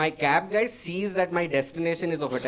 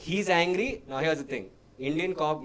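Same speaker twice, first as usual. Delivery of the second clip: normal talking voice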